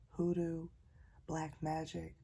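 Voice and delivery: usual speaking voice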